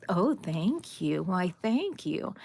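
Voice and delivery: quiet slow thank you; gentle grateful murmur; soft thanks. Real, not acted. Thanking viewers softly.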